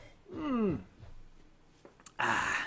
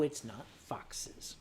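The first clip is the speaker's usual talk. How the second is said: whispered aside; 7 semitones lower, 10 dB quieter, slower than usual. Real, not acted. Aside to himself.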